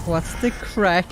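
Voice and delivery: high, drawn-out Irish greeting, hammed up; exaggerated Irish accent, stretched and high-pitched; camped-up Irish accent. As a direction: mimic a campy Irish voice, slow and high. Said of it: "she's camping it up a bit".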